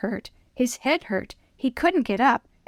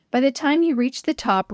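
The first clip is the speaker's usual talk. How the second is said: ordinary delivery, nothing marked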